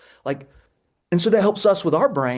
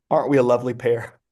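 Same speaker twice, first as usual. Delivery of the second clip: ordinary delivery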